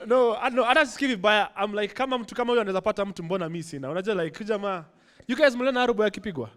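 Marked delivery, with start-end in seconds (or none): none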